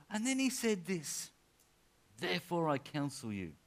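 urgent preacher exhorting the church; emphatic preaching. Real, not acted. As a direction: preach it with deliberate, punched key words.

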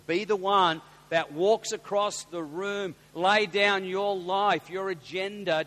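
slow, loud and deliberate, each phrase hit hard; preaching emphasis. Real, not acted. Preacher urging the congregation to walk across the room, slow and loud. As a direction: lean in and drive the words home slowly. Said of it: "preacher drives home the point".

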